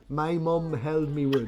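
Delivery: "me wood" in his own Scottish accent. Shown as a demonstration. Showing how he says the mug line, in his own accent. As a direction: lean into your own brogue on "me wood". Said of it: "you can hear me saying".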